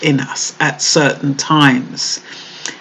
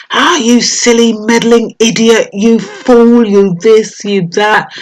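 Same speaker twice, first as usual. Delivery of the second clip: mock angry shouting; 6 semitones higher than usual. Husband yelling insults at his wife on their anniversary, mock angry. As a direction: berate her like the furious husband. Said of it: "shouted at his wife".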